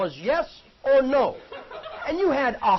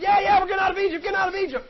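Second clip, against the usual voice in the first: excited cheering; 8 semitones higher, 4 dB louder, faster than usual. Acted. Israelites cheering about leaving Egypt.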